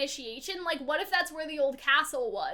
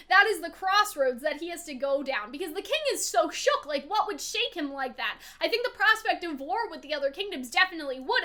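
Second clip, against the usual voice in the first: ordinary delivery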